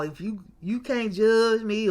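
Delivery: mock pleading in a put-on voice; mocking whiny imitation. Vlogger mocking hesitant confessions, put-on whiny mock. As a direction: parody people who beat around the bush. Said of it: "don't be all".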